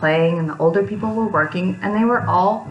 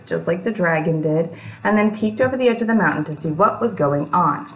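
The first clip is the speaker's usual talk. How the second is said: ordinary delivery, nothing marked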